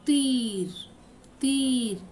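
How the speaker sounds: "तीर" with a long held ī; pronunciation demo. Shown as a demonstration. Teacher modelling for children, drawn-out ī in "तीर". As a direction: stretch the ī in "तीर" before the final r. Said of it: "इसका pronunciation किस तरह करना है".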